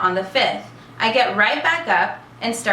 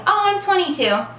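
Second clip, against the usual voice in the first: pitched way up, playfully fibbing about her age; high, coy, pretend twenty-two; mock youthful answer; 9 semitones higher than usual. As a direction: chirp it like a young girl pretending. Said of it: "I feel like saying".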